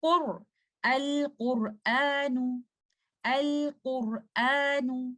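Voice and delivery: QUR dark, then Al-Qur'anu drawn out slowly; correct heavy ra. Shown as a demonstration. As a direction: model 'Al-Qur'anu' very slowly with thick 'qor'. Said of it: "say qur".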